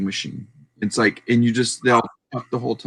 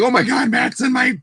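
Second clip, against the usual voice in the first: excited shout; 9 semitones higher than usual. Retold or imitated. Streamer Yakov shouting as Matt joins his live. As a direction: blurt it out, star-struck. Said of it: "He was like".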